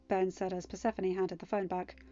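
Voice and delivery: usual speaking voice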